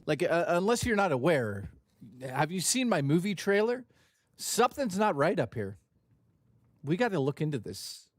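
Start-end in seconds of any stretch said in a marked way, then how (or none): none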